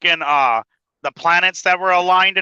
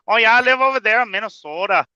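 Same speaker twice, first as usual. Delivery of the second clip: higher, hammed-up Minnesotan accent; Minnesota accent; 5 semitones higher than usual. Playing someone who lives in Minnesota, Minnesota accent. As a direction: lean into a mock Minnesota accent. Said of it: "her accent would come out strong".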